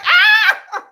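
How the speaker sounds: high, loud startled shriek; startled scream. Retold or imitated. Jin startled, shrieking at her. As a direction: shriek in shock at the sight.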